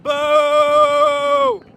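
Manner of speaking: drawn-out jeering boo. Real, not acted. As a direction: boo at them from the crowd. Heckling officials at a protest.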